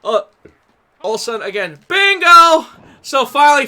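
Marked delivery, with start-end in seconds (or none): 1.9-2.7 loud, high yell of bingo; a bingo player yelling over the promo; shouted bingo call. Retold or imitated. Old lady in the crowd shouting bingo, loud.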